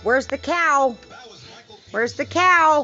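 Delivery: ordinary delivery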